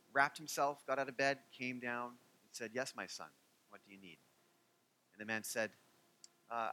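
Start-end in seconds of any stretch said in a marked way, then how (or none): 2.7-4.2 soft, patient reply; gentle rabbi. Retold or imitated. Hillel answers the man's second call, softly. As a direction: answer him calmly, like a kind teacher. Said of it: "he had such a quiet way".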